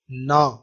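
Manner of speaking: نا said alone as a clear, drawn-out na; isolated na sound. Shown as a demonstration. Teacher isolating the consonant for the class, sounding out نا. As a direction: sound out نا slowly for students. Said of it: "پونی میں دیکھیں این".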